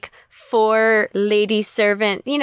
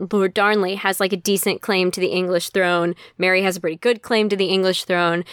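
ordinary talk

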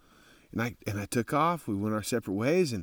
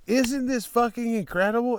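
excited; 10 semitones higher than usual. Retold or imitated. Rider gushing to strangers.

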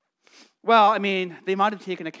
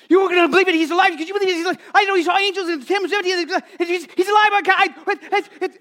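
much higher and louder, stumbling over words; breathless overjoyed stammer, much higher; overwhelmed excitement; 11 semitones higher, 7 dB louder, faster than usual. Acted. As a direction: spill the news so fast the words trip. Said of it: "they're just overwhelmed".

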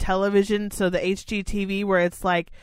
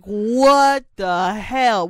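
slow, drawn-out, louder "what the hell"; mock disbelief; 7 dB louder, much slower than usual. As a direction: stretch it out in stunned disbelief. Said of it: "And I was like".

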